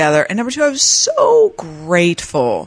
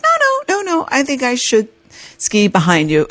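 high mocking voice; 6 semitones higher than usual. Man insisting, mock high voice.

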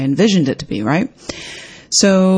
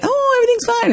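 high, falsely bright everything is fine; fake cheerful; much faster than usual. Pretending all is fine to hide being upset, mock chirpy. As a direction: chirp it like a forced smile. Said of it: "fake it".

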